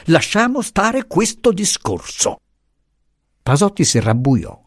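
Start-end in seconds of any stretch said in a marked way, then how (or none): 0.1-2.4 high, slower, pressing the refusal; slower, insistent repeat. A narrator's reading. Professor repeating his refusal to Pasotti, slower and insistent. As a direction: say it again, slower and firmer. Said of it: "diss'egli impetuosamente".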